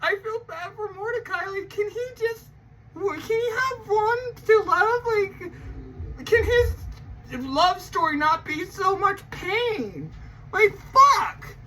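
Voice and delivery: high, teary mock sobbing; hammed-up heartbreak. Real, not acted. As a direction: whine in half-joking grief for a cartoon. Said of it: "It, it hurts me".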